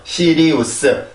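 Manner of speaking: "시리우스" slowly, syllable by syllable; spelling pronunciation. Shown as a demonstration. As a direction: read "시리우스" slowly as four Korean syllables. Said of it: "이 스펠링을 그대로 보고". (철자 그대로 느리게 "시리우스")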